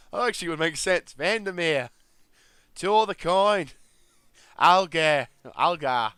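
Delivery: loud, high, hammed-up Aussie; terrible Australian accent. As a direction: ham it up as a bad Australian. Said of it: "my terrible Australian accent".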